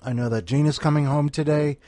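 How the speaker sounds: usual speaking voice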